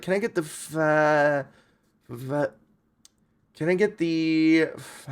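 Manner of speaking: hesitant mock-stammer over the word; nervous customer stumbling on the name; a lightly voiced flustered diner. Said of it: "they're like".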